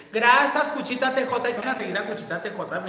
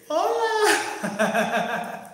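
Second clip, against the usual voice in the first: high, held-out hello; hammed-up hello; 8 semitones higher, 5 dB louder than usual. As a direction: stretch it out high, comically. Retold greeting when caught in bath. (hola agudo y alargado, exagerado)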